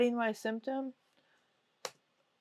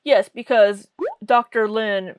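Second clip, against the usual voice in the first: ordinary talk, nothing marked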